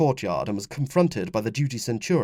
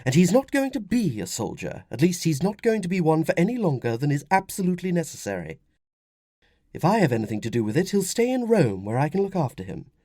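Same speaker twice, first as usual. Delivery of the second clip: high, insistent mother's voice; firm; 7 semitones higher than usual. Acted. Wife to husband about son's future, firm. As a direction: lay down the law as a protective wife. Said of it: "Flavia said firmly".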